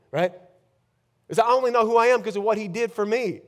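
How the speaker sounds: usual speaking voice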